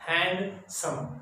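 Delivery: Handsome slowly split as hand, then सम; two separate syllables. Shown as a demonstration. Teacher demonstrating a two-syllable word, handsome said in two pieces. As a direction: pause inside handsome before सम. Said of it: "टू syllable word है ये".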